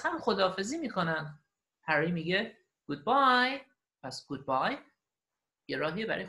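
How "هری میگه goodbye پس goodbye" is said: ordinary delivery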